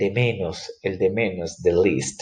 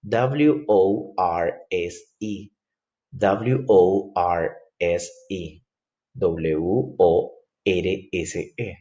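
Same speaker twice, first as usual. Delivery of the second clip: very slow letter-by-letter spelling; dictating each letter to the class; 3 semitones lower, much slower than usual. Shown as a demonstration. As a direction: spell "W O R S E" one letter at a time, slowly. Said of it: "Mire cómo se escribe worse".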